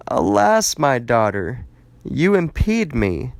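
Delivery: high, anguished lament from a father; a read-aloud father's lament, pitched high and slowed; grieving cry. A narrator's reading. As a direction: cry it out as a father undone by grief. Father sees daughter, tears garments.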